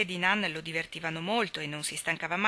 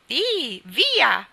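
high, loud giddy-up cry; little girl's shout; 7 semitones higher, 9 dB louder than usual. A narrator's reading. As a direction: squeal it out to drive the horses on. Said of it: "gridò la principessina". (grido acuto da bambina)

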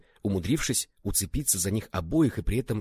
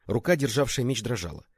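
usual speaking voice

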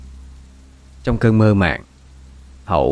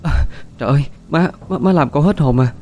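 startled cry; 5 semitones higher than usual. Acted. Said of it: "cô giật mình hét toáng lên".

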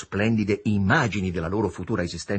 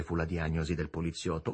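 usual speaking voice

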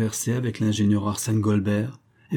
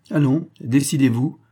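commanding, louder and higher; authoritative; 5 semitones higher, 5 dB louder than usual. A narrator's reading. Billionaire pressing the hesitant brothers, authoritative command. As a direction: push them to decide, no arguing. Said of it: "dit le milliardaire avec autorité".

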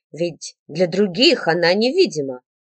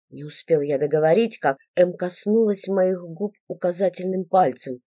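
usual speaking voice

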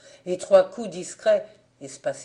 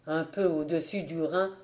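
ordinary delivery

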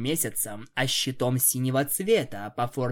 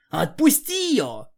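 demanding shout; 9 semitones higher, 5 dB louder than usual. Acted. Demands Gladys release Nyx.

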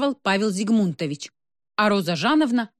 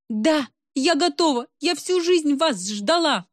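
eager; 8 semitones higher, slower than usual. A narrator's reading. Eager woman begging ritual.

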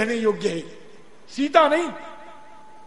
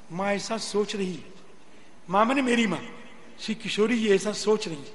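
ordinary talk

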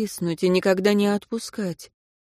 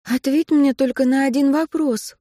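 pleading; 7 semitones higher, 4 dB louder than usual. Acted. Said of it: "взмолилась Катя".

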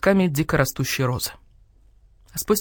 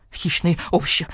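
plain reading voice

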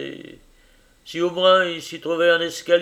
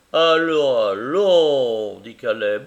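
ordinary delivery, nothing marked

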